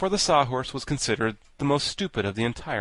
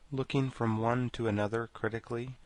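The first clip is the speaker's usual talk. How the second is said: usual speaking voice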